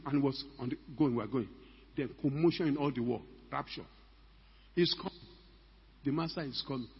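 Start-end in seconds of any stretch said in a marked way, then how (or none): none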